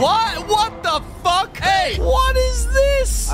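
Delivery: high, drawn-out squawk of disbelief; shocked outburst. Real, not acted. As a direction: cry out in high-pitched alarm at the screen. Startled outburst at what appears on screen.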